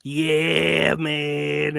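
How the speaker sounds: lightly imitated oversold commentator catchphrase; hint of a hammy wrestling commentator; Booker T. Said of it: "Booker T was just overselling the".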